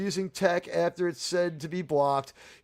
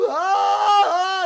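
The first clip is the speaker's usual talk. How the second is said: high, loud mock scream; 8 dB louder than usual. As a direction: scream like a frozen bystander. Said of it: "stand there and go".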